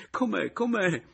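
high, loud repeated cry of disbelief; overwhelmed high exclamation; tearful astonishment. A narrator's reading. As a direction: blurt it twice, overcome. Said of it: "che piangeva anche lui".

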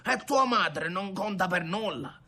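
high-pitched affronted questions; indignant grandfather. Acted. Grandfather rebuking grandson over marriage, indignant.

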